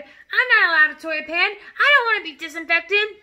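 loud, high indignant protest; alarmed cry. Acted. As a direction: protest like a panicking husband. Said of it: "the husband cried".

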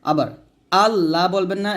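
ordinary talk

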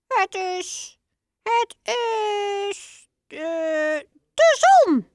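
unsure squeaky mouse father; hesitant father mouse. Acted. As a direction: stumble before blurting it out.

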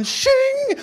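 high mock sword shing; a sharp comic blade noise; sword sound effect. Preacher acting out Peter's sword draw, mock shing.

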